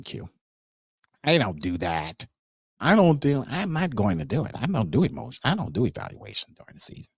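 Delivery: a lightly voiced, goofy impression of the GM being defensive; joking parody of a sulky executive; stupid mocking voice. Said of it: "I'm joking and making a stupid voice".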